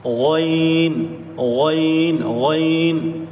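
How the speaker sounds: غین held long from the throat; drawn-out ghain demo. Shown as a demonstration. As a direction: stretch غین slowly like a recitation teacher. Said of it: "شروع والے حلق سے نکالیں گے".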